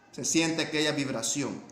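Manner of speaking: ordinary talk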